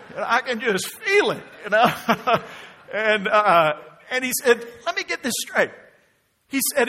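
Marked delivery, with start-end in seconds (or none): 4.9-5.7 high-pitched mock incredulity, imitating someone; mock-puzzled and higher; sarcastic. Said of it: "what sounded sarcastic".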